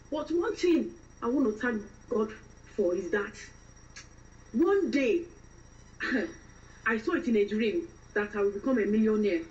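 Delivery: fresh-from-Nigeria woman, testifying and complaining; Nigerian accent. Shown as a demonstration. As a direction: play an aggrieved, devout Nigerian woman in London. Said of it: "start off with a fresh Nigerian woman".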